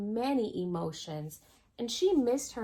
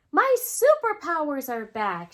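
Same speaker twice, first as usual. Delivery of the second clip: louder, higher and excited; happy announcement; 5 semitones higher, 7 dB louder than usual. A narrator's reading. Bree telling everyone her joy returned, loud excited cheer. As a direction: proclaim it like a child whose joy is back. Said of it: "she said".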